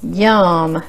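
usual speaking voice

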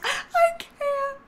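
high, whiny mock cry; hammed-up pretend weeping; mock sobbing. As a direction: wail it like a pet who can't cope. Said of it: "She's like".

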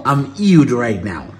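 playful disgust. Real, not acted. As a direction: drag it out, grossed out.

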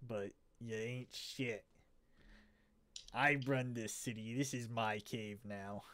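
swaggering rat thug sizing up the big guy; tough rat gangster. Acted. Game master voicing Tony the rat taunting the big guy, tough swagger.

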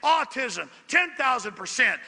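ordinary talk, nothing marked

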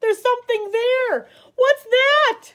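high, loud, startled cry; alarmed. A narrator's reading. Eddie spots something in the dark, alarmed. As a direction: build to a frightened, high-pitched question.

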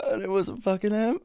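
ordinary talk

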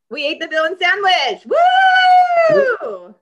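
loud victorious cheer; triumphant shout. Real, not acted. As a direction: yell it out in triumph. Triumphant shout after challenge.

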